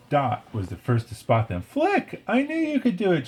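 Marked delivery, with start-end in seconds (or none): 1.6-3.3 put-on very high, thrilled little-girl call; excited shout. A narrator's reading. Dot greeting Flick's return, overjoyed. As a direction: cheer it as a delighted kid. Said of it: "she shouted".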